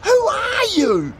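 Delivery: high, loud demanding shout. A narrator's reading. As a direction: demand the truth, alarmed. Tim demands answer.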